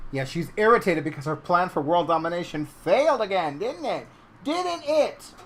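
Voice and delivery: loud, high teasing of a pet; mock taunting. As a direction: crow playfully over a foiled villain. Teasing cat about foiled plan.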